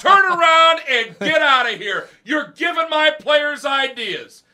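very loud, high furious coach shout; coach yelling. Retold or imitated. Coach shouting at injured players to leave field, furious shout. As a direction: bellow it across the field.

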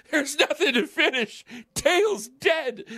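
put-on a heartbroken child announcing the death; high, drawn-out despair; kid's grief. Grieving kid retold.

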